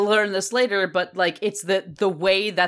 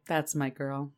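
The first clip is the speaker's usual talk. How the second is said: tender pride; 6 semitones lower, 9 dB quieter than usual. Retold or imitated. Cassie's fond pride.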